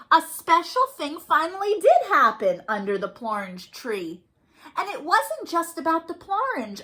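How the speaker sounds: put-on lively, sing-song read-aloud for little kids; storytime reading. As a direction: read it like a picture book to small children. Reading picture book to kids.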